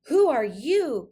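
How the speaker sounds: sudden startled cry; gasped. A narrator's reading. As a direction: gasp it out in surprise. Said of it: "gasped Cindy Ellen".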